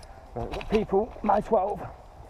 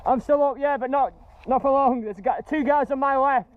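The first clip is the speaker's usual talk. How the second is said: higher, pressed voice under fire; tense and urgent; 8 semitones higher than usual. Real, not acted. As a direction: call it out while pinned down. Pinned down, enemies close.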